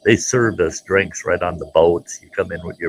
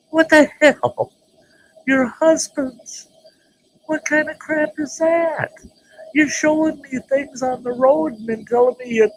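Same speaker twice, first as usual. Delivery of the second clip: indignant outrage. Retold or imitated. Narrator outraged at meeting the husbands, high indignant squeal. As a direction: squeal out the betrayal.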